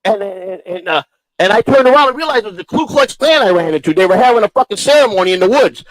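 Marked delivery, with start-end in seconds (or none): none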